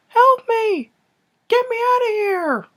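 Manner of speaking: very high falsetto cry for help; hammed-up falsetto pleading, last words drawn out; playing the body's voice. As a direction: squeal it like a trapped puppet. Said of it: "he's supposed to play, like, the voice".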